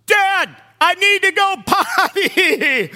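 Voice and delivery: put-on loud, high, slow child's call; loud whiny call. Son on the tarmac calling dad, loud and slow. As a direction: drag it out loud like a desperate kid. Boy shouting on plane again.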